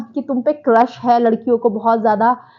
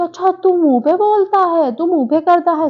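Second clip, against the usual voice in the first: higher, mocking put-on voice; taunting mimic of Sara; 6 semitones higher than usual. Sara taunting the guy during the fight, higher mocking voice. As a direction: mimic Sara's sarcastic jab. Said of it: "सारा बोलती है कि".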